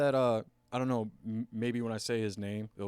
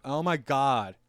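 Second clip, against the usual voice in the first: loud, higher exasperated queen; mock exasperation; 3 semitones higher, 7 dB louder, slower than usual. Sansa answering the messenger about choosing, mock exasperation. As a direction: brush it off with exaggerated impatience. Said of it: "She's like".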